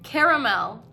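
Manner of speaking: caramel said CARE-uh-mel, rising at the end; three-syllable caramel. Shown as a demonstration. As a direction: keep the middle "uh" in caramel. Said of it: "How do you say this?".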